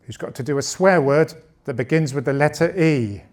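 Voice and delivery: normal talking voice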